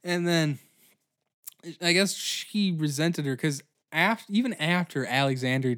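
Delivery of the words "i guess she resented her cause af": ordinary talk, nothing marked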